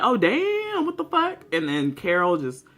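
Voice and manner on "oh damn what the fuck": high shocked exclamation; retelling a stunned reaction, voice leaping high. Retold or imitated. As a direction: jump way up in pitch in disbelief. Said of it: "I was like".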